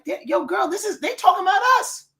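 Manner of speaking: high, excited mock alarm; gossiping to a girlfriend in dawning shock; startled recognition. Viewer telling her girl they're being talked about, acted startled recognition.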